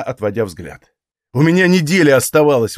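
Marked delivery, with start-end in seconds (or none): 1.3-2.8 higher, louder protest; indignant. A narrator's reading. Hero defends his choice of gift, indignant. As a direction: push back, stung. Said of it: "возмутился я".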